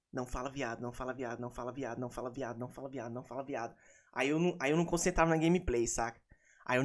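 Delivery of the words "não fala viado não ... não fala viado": low, hushed chant on repeat; muttered self-reminder. Retold or imitated. Player reminding himself not to swear, muttered chant. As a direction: mutter it under your breath like a mantra. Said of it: "eu ficava a partida inteira assim, ó".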